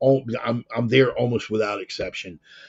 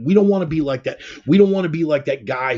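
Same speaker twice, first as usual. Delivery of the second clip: higher and louder, hammering "don't"; emphatic outburst; 6 semitones higher, 6 dB louder, faster than usual. Real, not acted. Host urging believers not to repeat past failures, loud and emphatic. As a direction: throw it out as a rallying cry. Preaching about the nation's duty.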